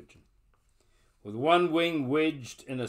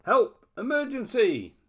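high loud slow call; calling for help; 13 semitones higher, 5 dB louder, slower than usual. Acted. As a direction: cry out for help. The cry goes up.

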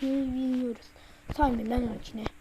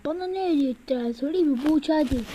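ordinary talk

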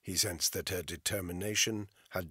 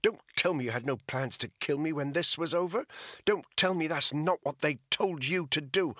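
furious accusation; 10 semitones higher, 7 dB louder than usual. Acted.

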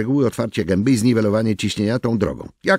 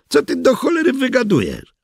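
much higher, irritated question; raised voice. Acted. Worker snapping at his coworker Janis. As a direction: bristle at the accusation.

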